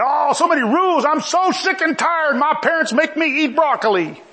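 whiny complainer. Pretend. Mocking chafing attitude.

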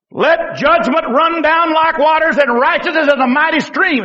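declamatory preacher's reading; ringing proclamation. Acted. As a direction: proclaim the verse to the congregation. First emphatic reading of the verse.

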